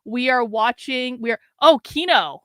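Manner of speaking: ordinary talk, nothing marked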